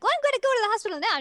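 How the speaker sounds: very fast breathless rush. Retold or imitated. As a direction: blurt it out in a hurry. Kagome rushing out.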